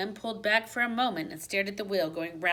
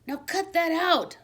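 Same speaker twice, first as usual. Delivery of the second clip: high, louder squeak of a scold; 9 semitones higher, 4 dB louder than usual. A narrator's reading. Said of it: "I squeaked at him".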